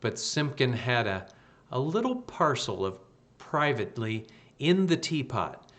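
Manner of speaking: ordinary delivery, nothing marked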